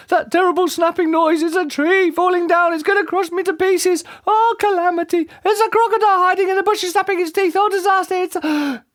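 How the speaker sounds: very high hysterical gabble; shrieked. A narrator's reading. Mister Jelly imagining falling trees and crocodiles, shrieking. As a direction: shriek out mounting squeaky panic. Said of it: "he shrieked".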